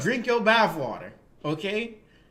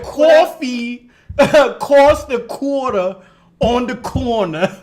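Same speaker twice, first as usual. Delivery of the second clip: slow, loud mock New York accent; exaggerated New Yorker, high and slow; imitating co-host's New York accent; 5 semitones higher, 9 dB louder, much slower than usual. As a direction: drag out the New York vowels, laughing. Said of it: "trying to say it like her".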